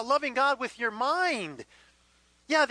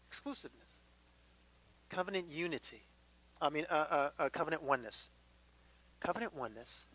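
much softer, lower, almost hushed; soft intimate; 8 semitones lower, 12 dB quieter, faster than usual. Real, not acted. Pastor to the congregation, hushed and low. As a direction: lean in and lower your voice to the congregation. Intimate quiet emphasis of point.

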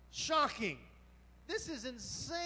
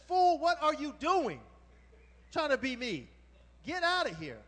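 loud scolding, hammed-up; mock angry Esau; 3 semitones higher, 8 dB louder than usual. Preacher voicing Esau telling Jacob to get out, loud scolding. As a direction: bark it like an offended older brother.

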